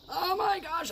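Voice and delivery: very high, excited gush; thrilled, high-pitched exclamation; delighted squeal. Real, not acted. Delighted by the dyed filter.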